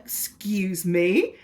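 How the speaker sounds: slow, drawn-out mock outrage; retold disbelief. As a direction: stretch it out as if you misheard. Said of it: "And I was like".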